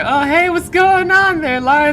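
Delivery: high, loud silly voice; playful caricature of a passer-by; mock Danny voice. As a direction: hail a friend in a goofy put-on voice. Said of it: "it's like".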